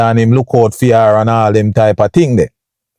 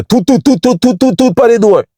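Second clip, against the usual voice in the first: high, far-off toot toot knocking sounds; imitated knocking; 10 semitones higher than usual. Telling viewers how the feds knocked, imitated knocking. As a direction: mimic the cops rapping on the door. Police knocking at her door.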